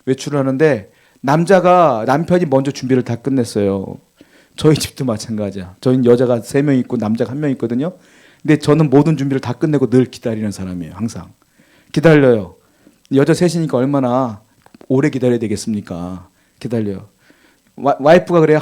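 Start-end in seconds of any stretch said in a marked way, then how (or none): none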